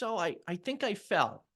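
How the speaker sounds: high, hesitant admission; high-pitched, faltering mom voice; imitating mom. Voicing confused mother's answer.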